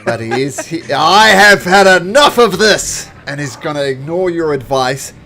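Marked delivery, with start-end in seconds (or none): 0.9-3.1 slow, much higher, booming shout; fed-up roar. Acted. Captain fed up before attacking, furious roar. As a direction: bellow it out, each word drawn out.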